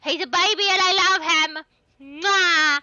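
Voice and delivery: high, loud gushing over a pet. Real, not acted. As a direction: squeal with love for the puppy. Gushing over the in-game dog.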